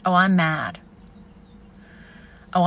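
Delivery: normal talking voice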